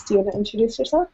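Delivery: usual speaking voice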